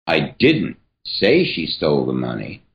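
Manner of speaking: emphasis on "say"; stress on say. Shown as a demonstration. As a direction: say lifted and stressed. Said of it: "emphasis here is going to change every single time".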